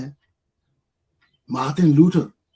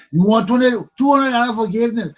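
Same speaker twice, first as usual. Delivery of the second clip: higher, louder mock preacher selling; imitating a greedy pastor, higher and louder; mock pastor pitch; 7 semitones higher, 6 dB louder than usual. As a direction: hawk forgiveness like a pushy pastor. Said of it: "the pastor said".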